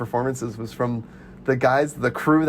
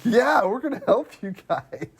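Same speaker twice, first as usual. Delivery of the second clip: eager crowd volunteering; 8 semitones higher than usual. Retold or imitated.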